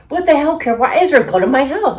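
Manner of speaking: high, loud outraged retelling; mock a furious dad demanding answers; angry dad. Dad confronting daughter about a goat in the house, acted angry. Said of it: "he is so angry".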